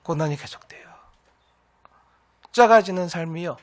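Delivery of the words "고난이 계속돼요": much softer and lower, almost whispered; hushed echo. Real, not acted. Preacher repeating that hardship continues, hushed. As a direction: let the phrase sink in very quietly.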